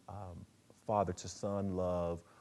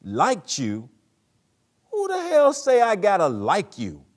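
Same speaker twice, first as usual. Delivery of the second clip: much higher, much louder furious shout; Troy, angry; 12 semitones higher, 16 dB louder than usual. Acted. Father shouting at his son, furious. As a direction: blast it back at him in outrage. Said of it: "where Troy".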